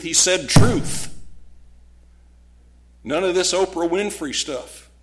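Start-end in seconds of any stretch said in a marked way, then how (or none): 0.5-1.1 "Truth" punched out loud; emphatic TRUTH. Acted. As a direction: hit "Truth" hard as the final word. Contrasts with my, your truth.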